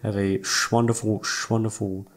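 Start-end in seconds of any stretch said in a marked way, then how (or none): none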